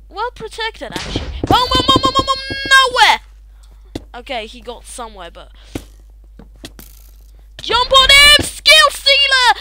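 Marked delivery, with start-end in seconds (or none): 1.5-3.2 high, loud boom boom; excited booms. Real, not acted. Attacking enemies in the game, excited high booms. 7.6-9.5 high, loud urgent call; excited shout. Real, not acted. Diving onto an enemy in the game, loud excited shout.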